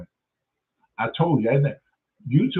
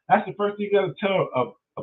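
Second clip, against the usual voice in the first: ordinary talk, nothing marked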